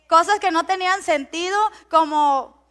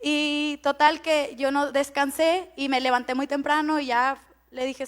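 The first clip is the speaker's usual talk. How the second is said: normal talking voice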